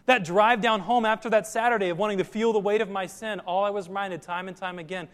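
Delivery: ordinary delivery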